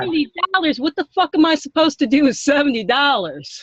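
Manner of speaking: hammed-up angry customer; mock outrage. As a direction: let the fake fury fly, high and swearing. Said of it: "now we're talking".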